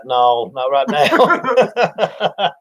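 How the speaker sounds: slow words through laughter; laughing refusal. Retold or imitated. Friend stuck in the tree, laughing as he says no. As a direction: laugh it out, barely getting the words.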